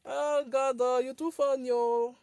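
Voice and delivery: amused and loud, fresh from a real laugh; amused exclamation. Real, not acted. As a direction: exclaim it, tickled by a joker. Laughing at a funny viewer.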